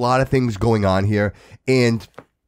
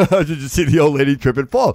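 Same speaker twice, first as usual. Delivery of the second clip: amused mock glee; 3 semitones higher, 4 dB louder than usual. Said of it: "you might be like".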